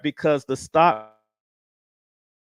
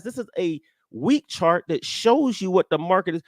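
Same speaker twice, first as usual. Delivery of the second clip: ordinary talk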